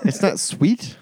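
high, loud mock disbelief; mock surprise. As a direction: squeak it in fake shock. Mock shock at beer.

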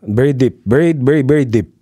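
lightly done deep Filipino accent. Shown as a demonstration. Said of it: "very strong accent".

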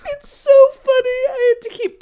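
high, squealing through laughter; real laughter. Real, not acted. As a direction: barely get it out for laughing. Cracking up at the scene.

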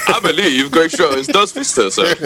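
mock news reporter. Teasing friend imitating his claim, mock news-reporter voice. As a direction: mimic a newsreader, drawn out and amused. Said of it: "like a news reporter".

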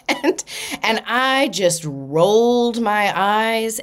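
slow words half laughed out; laughing through it. Real, not acted. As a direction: still chuckling at the irony. Laughing while telling.

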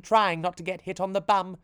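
ordinary delivery, nothing marked

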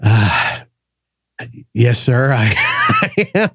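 cracking up while retelling the answer; laughing through it. Real, not acted. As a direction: laugh while you confess.

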